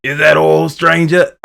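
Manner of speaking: high, loud hammed-up merchant voice; merchant impression. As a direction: push it up high and loud, mock shopkeeper. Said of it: "Hey, I'm not that big".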